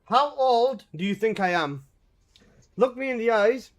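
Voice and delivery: usual speaking voice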